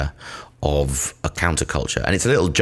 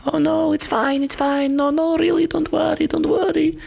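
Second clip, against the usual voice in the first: squeaky falsetto, far higher, hiding anger; fake polite reassurance. Barista reassures customer about the mess, falsetto fake politeness. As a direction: sweetly insist it's fine while seething. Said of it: "but I'm actually seething inside".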